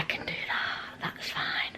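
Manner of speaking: whispered inner voice, slowed; whispered thought. Retold or imitated. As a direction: whisper it under your breath. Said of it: "I thought".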